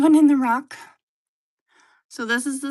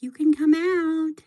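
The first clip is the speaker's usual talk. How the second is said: pitched-up coaxing pet; a higher coaxing pet voice; 6 semitones higher, 8 dB louder than usual. Pretend. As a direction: coax like the sister pet, higher. Voicing sister pet.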